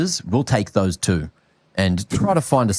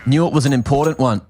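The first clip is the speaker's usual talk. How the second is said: esports play-by-play; 3 semitones higher than usual. Acted. Continues casting.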